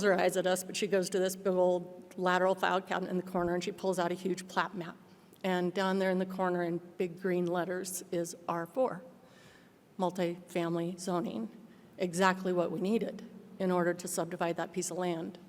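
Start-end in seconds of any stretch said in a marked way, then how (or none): none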